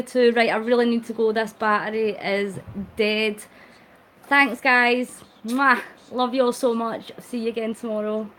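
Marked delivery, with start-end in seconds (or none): 5.5-5.8 loud kiss sound; blown kiss. Real, not acted. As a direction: blow a kiss to viewers. Blows a kiss.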